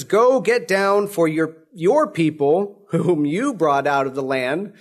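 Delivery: ordinary talk, nothing marked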